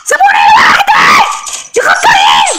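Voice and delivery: shrill loud yelling; exasperated mom scolding. Real, not acted. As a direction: scream at your teammates. Left alone, yelling at team.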